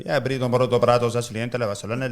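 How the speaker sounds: hammed-up Spanish gibberish; fake Spanish gibberish. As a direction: babble confidently in fake Spanish. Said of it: "this conversation in Spanish".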